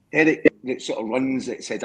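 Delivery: normal talking voice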